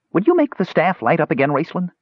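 high, hopeful asking; hopeful kender. Acted. Kender gazes at the mage, high hopeful plea. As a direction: ask hopefully like a child.

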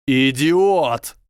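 high, loud yell of rage. A narrator's reading. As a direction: bellow the insult furiously. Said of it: "заорал Драко".

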